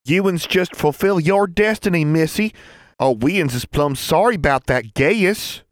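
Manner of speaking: high, loud mock Ozarker twang; mocking put-on Ozarker accent, exaggerated and loud; terrible Ozarker accent. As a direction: badly imitate an Ozarker, high and drawn out. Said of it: "That was a terrible Ozarker accent".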